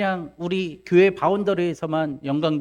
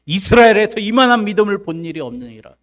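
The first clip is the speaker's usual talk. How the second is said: loud, raised proclamation; proclaiming; 6 semitones higher, 8 dB louder than usual. Acted. Jesus declares the centurion's faith to all, loudly proclaiming. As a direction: declare it out high and strong. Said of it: "그렇게 선포하십니다".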